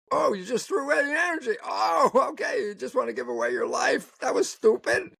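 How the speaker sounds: high, fast, teasing rebuke; mock scolding. Teasing a man who wasted his energy arguing, mock-outraged and high.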